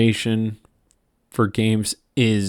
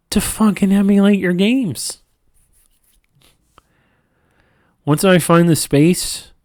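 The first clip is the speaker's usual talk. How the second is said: frustrated outburst; 9 semitones higher, 3 dB louder than usual. Real, not acted. Frustrated rant at Nintendo.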